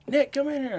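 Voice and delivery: high-pitched mock call for help; high, urgent cry without full volume; retold scream. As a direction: imitate a frightened wife calling out. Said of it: "she's screaming".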